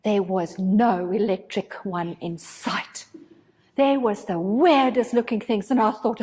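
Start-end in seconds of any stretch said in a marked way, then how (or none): none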